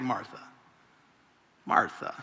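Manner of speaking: hushed, gentle, almost whispered; soft Jesus. Retold or imitated. Jesus gently calming frantic Martha, hushed. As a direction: say her name softly to calm her. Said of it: "He's like".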